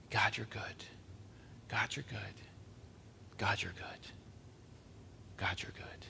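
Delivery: hushed whisper, each repeat fainter; whispered praise. Real, not acted. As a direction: breathe it as a private prayer.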